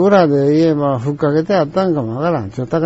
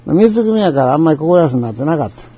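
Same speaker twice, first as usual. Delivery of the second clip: normal talking voice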